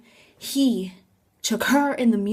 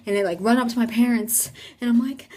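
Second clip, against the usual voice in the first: ordinary delivery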